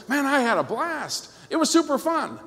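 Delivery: high, gushing report of fun; mock enthusiasm. Husband telling Kelly about his evening, high excited delivery. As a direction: gush like a party lover.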